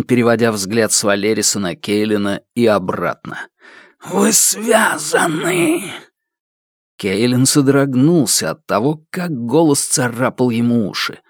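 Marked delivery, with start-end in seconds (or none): 4.0-5.9 high, slow shriek of a dark spirit; shrieking creature cry. Acted. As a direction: screech the realization. The shadow cries out in shock.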